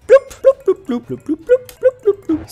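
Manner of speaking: high, bouncy mouth-sound bloops; playful bloop noises. Real, not acted. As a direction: pop each bloop like a cartoon bubble. Sound effect while placing blocks.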